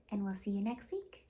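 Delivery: usual speaking voice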